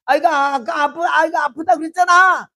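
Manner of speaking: high, loud accusing yell; yelling at husband. Retold or imitated. Mother shouting at husband over the dead child, loud. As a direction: blame him in a shouted burst. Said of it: "나 같으면 남편한테 한바탕에".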